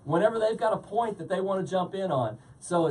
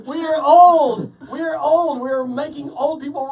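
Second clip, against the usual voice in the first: laughing shout; 7 semitones higher, 11 dB louder than usual. Real, not acted. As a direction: shout it out, cracking up. Laughing outburst after promo bit.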